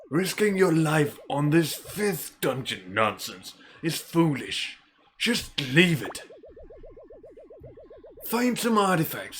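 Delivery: put-on exasperated elder warning a reckless boy; scolding old mentor. Old man lectures boy.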